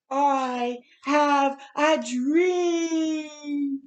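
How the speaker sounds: slow, loud, high, voice cracking with crying; drawn-out cry, overcome with real emotion; real tears. Real, not acted. Reader moved to tears quoting Martin's famous words, crying out. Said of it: "I'm even getting so teary-eyed".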